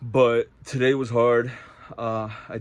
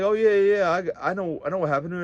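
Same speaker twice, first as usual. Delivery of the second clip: higher, eager oh yeah yeah; eager guy; 6 semitones higher, faster than usual. Retold or imitated. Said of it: "And he was like".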